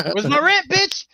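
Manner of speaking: shrill, loud demand for rent; cold greedy landlord. Acted. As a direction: bark it like a greedy landlord shaking down a tenant. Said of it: "And Big Daddy Anvil showed up".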